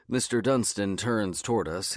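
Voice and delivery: usual speaking voice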